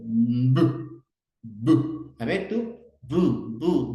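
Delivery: "bu" pushed out loud and hard through the lips; exaggerated bu. Shown as a demonstration. Therapist showing a child the lip sound "bu". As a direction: model "bu" boldly for the child to copy. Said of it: "por el punto y modo de articulación".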